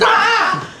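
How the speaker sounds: loud, very high imitated scream; mock terrified scream. Kid by the tree fort window surprised by dad, mock scream. Scared child at the treehouse window.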